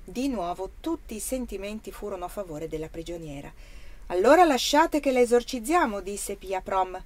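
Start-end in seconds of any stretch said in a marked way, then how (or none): 4.1-6.0 louder, higher proclamation to the crowd; calling out. A narrator's reading. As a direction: announce it forcefully to the assembly. Leader calls out a proposal to crowd. (proposta proclamata a gran voce)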